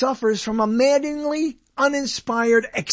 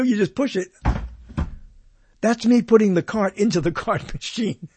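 ordinary talk

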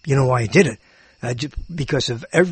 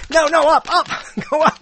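high, urgent little pleas; pleading kid; 10 semitones higher, 3 dB louder than usual. Retold or imitated. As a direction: coax the wish up like a child. Kid urging wish upward.

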